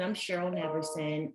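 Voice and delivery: ordinary talk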